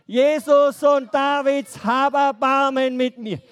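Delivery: loud pleading call, held out; loud cry for mercy. Shown as a demonstration. Children's teacher leads the blind man's cry. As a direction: call out loud, begging for help. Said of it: "Bei drei. Eins, zwei, drei".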